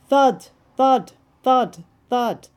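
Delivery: loud knocking thuds; loud knocks. A narrator's reading. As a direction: pound out the knocks. Frog knocking again.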